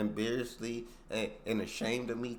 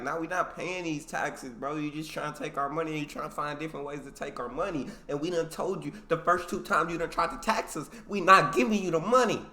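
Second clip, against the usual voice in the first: defiant colonists; 6 semitones higher, 8 dB louder than usual. Retold or imitated. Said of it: "But the Americans, like".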